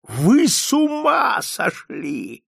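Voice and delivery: high, drawn-out outraged cry; roaring. A narrator's reading. Old man enraged at offer of clinic, roaring. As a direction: howl it slowly in outrage. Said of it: "заревел".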